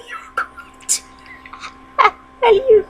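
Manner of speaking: high squeak dissolving into real laughter; cracking up. Real, not acted. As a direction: lose it mid-line and laugh.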